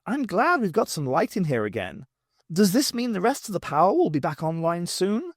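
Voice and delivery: bright and cheerful, lifted pitch; chirpy, upbeat question. A narrator's reading. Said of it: "Perry commented brightly".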